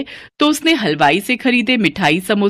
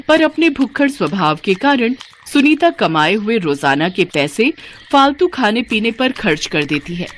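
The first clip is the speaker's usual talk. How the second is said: ordinary delivery, nothing marked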